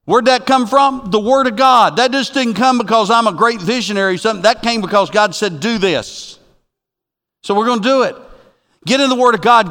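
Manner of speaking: high, loud pulpit proclamation; full-force preaching. Real, not acted. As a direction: proclaim it with urgent conviction.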